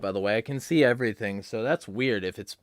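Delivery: ordinary delivery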